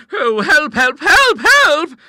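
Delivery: very high, loud shrieks for help; terrified yells. Acted. Two sisters on the settee before a snake, yelling in terror. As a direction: shriek it over and over. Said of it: "filled the whole house with their yells".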